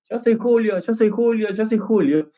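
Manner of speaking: high mock yell, repeated insistently; mock shout. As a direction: pretend to shout over everyone, pitched high. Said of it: "una persona en el medio gritando".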